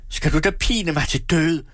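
high anguished outcry; anguished accusation. A narrator's reading. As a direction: accuse her in despair. Daughter in despair at mother.

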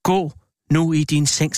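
usual speaking voice

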